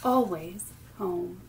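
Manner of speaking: sing-song picture-book reading, lilting rhythm; reading to children. Acted. Teacher reading rhyming book aloud at storytime, lilting. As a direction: read each line like a gentle rhyme for little ones.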